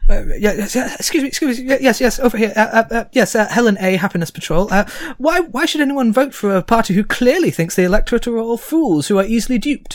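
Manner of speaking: high, pushy reporter calling out from the crowd; eager reporter. Acted. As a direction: shout over the crowd to get picked.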